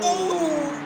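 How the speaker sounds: high startled exclamation; surprised cry. Real, not acted. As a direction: yelp in shock. Reacting to the crash.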